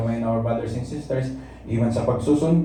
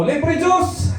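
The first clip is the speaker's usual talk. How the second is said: high, playful street call; demonstrating a shout to a crowd; public shout; 13 semitones higher than usual. Shown as a demonstration. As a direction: shout it like a hawker offering something free. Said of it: "pag sisigaw mo".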